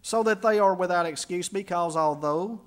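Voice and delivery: slowing on because although; stressed reading of the verse; scripture reading. Acted. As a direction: lean into the linking words. Rereads verse, emphasis on flow.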